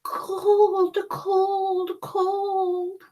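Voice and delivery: put-on high baby voices; shivering babies. The shivering babies complaining of cold. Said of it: "said the shivering babies".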